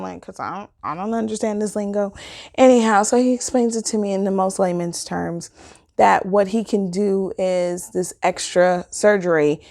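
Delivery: usual speaking voice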